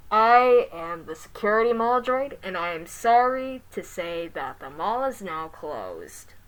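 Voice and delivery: loud, slow robotic announcement. Acted. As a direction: speak like a mall security robot. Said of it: "the robot said".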